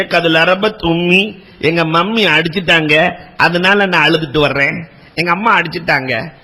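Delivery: low, soft, tearful complaint; crying young man. Acted. Abu Huraira tells the Prophet his mother beat him, low and tearful. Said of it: "அழுதுட்டு வர்றாங்க Abu Hurairah".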